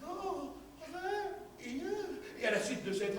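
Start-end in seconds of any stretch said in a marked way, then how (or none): 0.0-1.8 soft, broken and slow; broken stammer. Acted. Wife stammering softly in bed, broken. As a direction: stammer it out in pieces. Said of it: "balbutier à syllabes interrompues".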